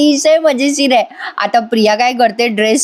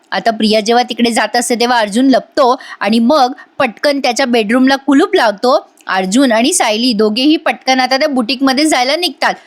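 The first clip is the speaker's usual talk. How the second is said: ordinary delivery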